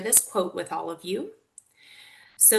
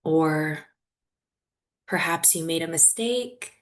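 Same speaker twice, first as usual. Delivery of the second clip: guided meditation. Real, not acted. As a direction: guide them gently through it, leaving long silences.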